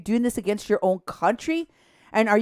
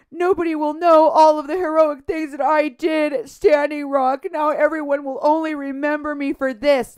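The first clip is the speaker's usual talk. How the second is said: raised-pitch imitation of a tearful complaint; mock crying; 6 semitones higher, 4 dB louder than usual. Host quoting the paramedic's tearful excuse, mock crying. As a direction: mimic her self-pitying wail, pitched up. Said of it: "she started crying and saying".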